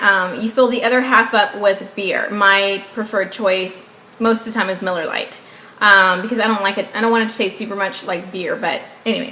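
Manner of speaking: ordinary talk, nothing marked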